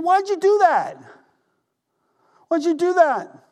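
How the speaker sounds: pleading why, almost squeaking with dismay; exasperated disbelief. Retold or imitated. Jesus rebuking disciples.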